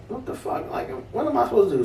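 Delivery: breathless whisper rising into a high, rushed plea; hushed then fast and high; panicked thought. Retold or imitated.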